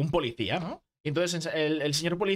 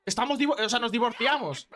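high-pitched mock outrage; irate wife, acted for a joke; furious wife; 8 semitones higher than usual. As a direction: throw your husband out, indignant and high. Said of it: "le mira súper cabreada y le dice".

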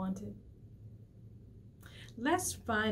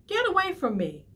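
high, loud shout; proud fish shouting; angry cry; 11 semitones higher, 6 dB louder than usual. Acted. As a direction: drive him off. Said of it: "cried the Rainbow Fish".

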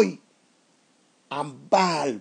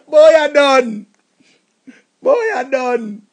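furious yell; 3 semitones higher, 8 dB louder, much slower than usual. Acted. Jilted man yelling at the new boyfriend, furious. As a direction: bark it out at the rival. Raging at rival.

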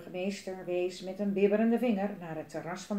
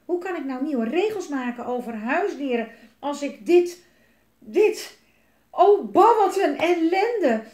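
loud, high exasperated outburst; exasperated rant; 6 semitones higher, 8 dB louder than usual. Acted. Papa orders the children to chase it away, ranting. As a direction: rant as a put-upon father. Papa rants about the dragon.

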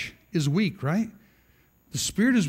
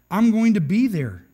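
loud, higher, insistent promise; confident boast; 4 semitones higher, 6 dB louder than usual. Acted. Peter insisting he will stand by Jesus, confident boast.

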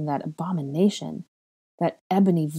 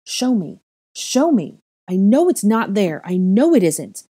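loud high frantic shouting; 4 semitones higher, 8 dB louder than usual. A narrator's reading. Girl grabbing his wrist and yelling, loud high. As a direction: grab and yell.

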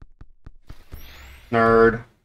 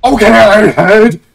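loud, raised cheer; excited shout; 7 semitones higher, 11 dB louder than usual. Real, not acted. Gamer hailing Iron Head's comeback, excited shout.

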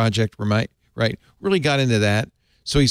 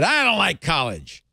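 Colonel Sanders voice; 9 semitones higher than usual. Retold or imitated. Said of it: "making him sound like Colonel Sanders".

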